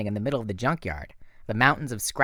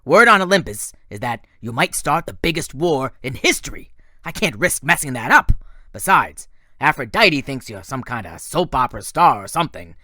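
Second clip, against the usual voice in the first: louder, taunting bully voice; Ares, menacing war god; 7 dB louder than usual. A narrator's reading. War god threatening Percy outside the limo, louder and taunting. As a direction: lean in and threaten the kid. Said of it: "he said".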